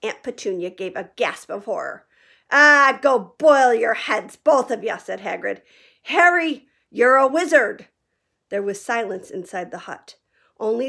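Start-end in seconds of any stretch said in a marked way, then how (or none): 2.5-5.0 loud, slow, scornful brush-off; dismissive Hagrid. A narrator's reading. Hagrid brushing off the Dursleys. 6.0-7.9 loud, warm declaration; big reveal. A narrator's reading. Hagrid telling Harry he is a wizard.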